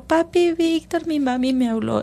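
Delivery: put-on a touch of a whiny child; imitating her daughter. Mother imitating daughter tattling to grandfather. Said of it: "Entonces dijo".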